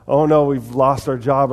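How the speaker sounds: loud mock-worried outburst; mock panic. Believers struggling with little bits, mock panic. Mimics people fretting over troubles.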